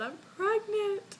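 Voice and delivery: high, drawn-out mock wail; imitated crying outburst. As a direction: blurt it out in a slow, pretend sob. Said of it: "I burst out crying and said".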